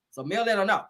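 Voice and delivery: normal talking voice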